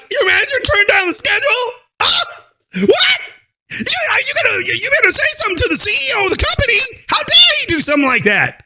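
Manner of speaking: high, shouted, hammed-up gasp of disbelief; mock outrage. Reacting to her manager story, hammed-up shocked outrage. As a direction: pretend to be scandalized, way up high.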